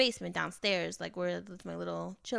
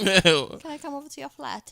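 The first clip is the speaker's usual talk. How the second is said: mock British accent; 2 semitones higher, 7 dB louder than usual. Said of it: "I love his accent".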